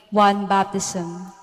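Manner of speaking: normal talking voice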